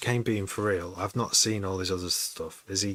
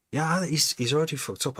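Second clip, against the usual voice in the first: excited; 8 semitones higher, 5 dB louder than usual. Real, not acted. Sudden burst of agreement.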